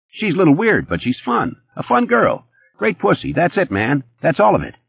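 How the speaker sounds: high, fast-talking nervous hustler; Eddie's voice. A narrator's reading. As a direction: pitch it up as jittery Eddie.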